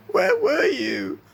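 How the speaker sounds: put-on very high, drawn-out child figure voice; little Isaac's plaintive question. A narrator's reading. Isaac questions the friend beside him, high and plaintive. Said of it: "Isaac murmured".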